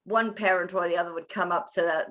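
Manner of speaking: ordinary talk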